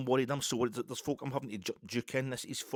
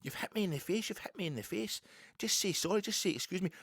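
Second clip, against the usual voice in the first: higher-pitched, insistent imitation of the woman; retold indignant complaint; 6 semitones higher than usual. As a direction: press for an apology as the aggrieved shopper.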